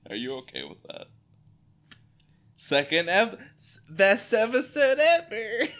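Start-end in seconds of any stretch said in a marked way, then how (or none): 2.6-5.8 high, cracking with laughter; giddy sarcasm through giggles; laughing so hard it breaks. Real, not acted. Laughing while recapping.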